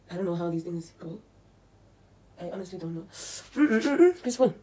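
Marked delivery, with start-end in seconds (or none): 3.1-4.2 high, loud humming mm-mm-mm; pleased hum. Real, not acted. Vlogger enjoying the mask, pleased hum.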